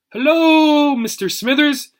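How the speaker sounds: high, loud, silly cartoon voice; weird cartoon voice. Tad greets the parrot in a silly cartoon voice. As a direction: ham it up like a cartoon character. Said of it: "making his voice sound all weird and funny".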